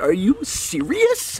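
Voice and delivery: drawn-out, rising incredulous mock outrage; mock road rage. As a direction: stretch it out in put-on disbelief, pitching upward. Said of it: "I get so mad sometimes".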